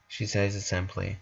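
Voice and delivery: ordinary delivery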